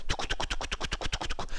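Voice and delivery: soft unvoiced tongue clicks, touc touc; clicking coaster chain. Instructor imitates the coaster climbing for the class, soft clicks. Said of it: "Un peu comme".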